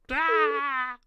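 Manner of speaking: loud, high screech; imp scream. Acted. Game master as imp distracting the orc, screaming. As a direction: let out a sudden imp's scream.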